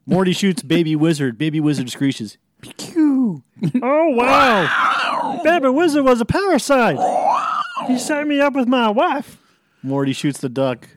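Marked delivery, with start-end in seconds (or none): 2.6-9.4 a tiny creature squawking in shock; squeaky creature voice. Acted. Baby Wizard voice.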